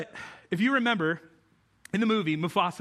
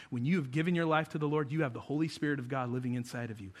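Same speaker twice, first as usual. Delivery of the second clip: ordinary delivery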